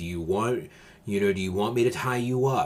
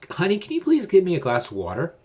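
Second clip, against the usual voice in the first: pleading request; 9 semitones higher, 5 dB louder, faster than usual. Shown as a demonstration. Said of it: "Don't say".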